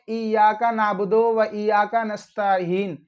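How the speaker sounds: नस्तईन misread as "nastaheen"; unstretched misreading, "heen" at the end of नस्तईन; mistaken h sound. Shown as a demonstration. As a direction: put an h in नस्तईन and end नाबुदो on o. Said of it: "बहुत सारे लोग हीन पढ़ते हैं".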